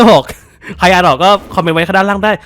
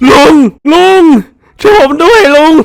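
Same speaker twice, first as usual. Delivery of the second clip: mock cry for help; 6 semitones higher, 6 dB louder than usual. Calling NPC uncle for help.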